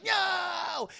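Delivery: high, drawn-out cry of protest; mock anguished cry. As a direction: cry out to stop the blow, hammed-up. Mimics Anakin crying out.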